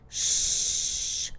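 long, soft shushing hiss; soft unvoiced shush; hissed hush. A narrator's reading. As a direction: hush everyone with a drawn-out hiss. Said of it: "hissed the raccoon".

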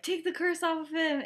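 mock-indignant command to a bully; retold stern demand. Lily demands curse be lifted.

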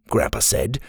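ordinary delivery

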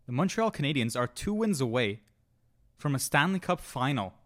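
ordinary talk

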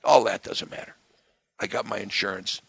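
usual speaking voice

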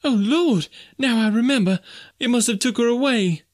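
high falsetto lament; wailing maid. A narrator's reading. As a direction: cry out as the horror comes back. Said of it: "commenced to sob and wail".

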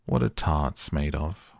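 ordinary delivery, nothing marked

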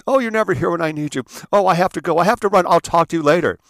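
high, loud flustered mom rushing out; hurried melodramatic mother scolding the father; frantic dramatic mother. Retold or imitated. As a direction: play the mother in a theatrical panic. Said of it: "Mother's running around".

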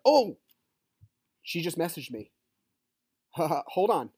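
high, loud "oh!"; startled surprise. Real, not acted. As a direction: burst out as if caught off guard. Message from her suddenly arrives.

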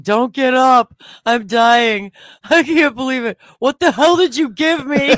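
high, loud panicked shouting; hammed-up accusing panic; mock freak-out. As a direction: act out losing it, loud and high. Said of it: "I'm freaking out to the point saying".

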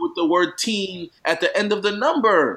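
high, loud disbelief; incredulous, high-pitched yelling; baffled outburst. Real, not acted. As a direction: shout it out, utterly baffled. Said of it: "I'm baffled by this".

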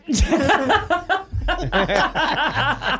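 long, high, whooping laugh; big laugh. Real, not acted. Guest on air cracking up, long high whooping laugh. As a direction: let the laugh ring out like a cheer. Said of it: "those big Reading movie cinema cheers".

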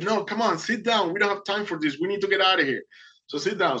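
high-pitched impatient retelling; urgent insistence. Retold or imitated. Boatman to panicking swimmer, urgent insistence.

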